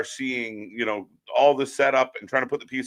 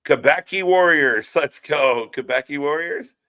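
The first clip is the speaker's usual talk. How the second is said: usual speaking voice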